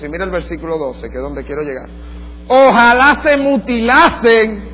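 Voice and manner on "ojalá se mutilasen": very slow, loud, higher outburst; biting outburst. Retold or imitated. Paul lashing out at the circumcision party, slow and loud. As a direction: spit out the wish slowly. Reading Paul's harshest line.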